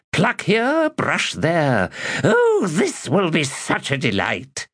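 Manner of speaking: high, giddy plotting aside; gleeful scheming. Acted. Magus plotting to charm two women, high and gleeful. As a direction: relish the plan like a wicked child.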